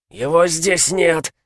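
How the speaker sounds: high, slow, strained cry; pained werewolf yell. Acted. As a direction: force it out through pain. Werewolf in pain, snarling. (сквозь боль, выкрикивая)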